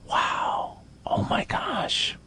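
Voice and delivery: breathy stage whisper of mock awe; mock awed whisper. Host mocking the bank's policy in a hushed, awed whisper. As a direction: gasp it in hushed mock wonder.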